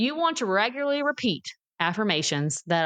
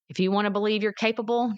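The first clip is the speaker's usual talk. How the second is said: ordinary delivery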